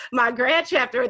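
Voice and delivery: words through real laughter; breaking up with giggles; laughing. Real, not acted. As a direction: laugh while you say it. Laughing telling a funny story.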